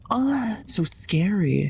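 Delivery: hammed-up scared gasp; gasp, then drawl it slowly; mock gasp. Mock fright.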